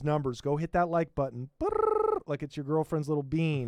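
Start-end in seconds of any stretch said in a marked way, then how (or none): 1.6-2.2 mock buzzing brrr. Mimics vibrating sound.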